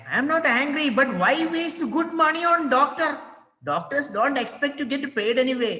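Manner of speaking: high, strained protest; exasperated husband. Acted. Husband protests to tearful wife, high and exasperated. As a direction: insist you're not angry while clearly annoyed.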